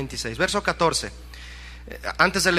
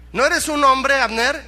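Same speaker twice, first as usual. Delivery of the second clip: raised, high questioning rebuke; acted scolding challenge; 7 semitones higher, 4 dB louder, slower than usual. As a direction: challenge his manhood from afar. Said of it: "hasta regañado salió". (reto en tono de regaño, voz alzada)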